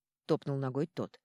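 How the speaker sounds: plain reading voice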